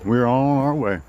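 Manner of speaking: ordinary talk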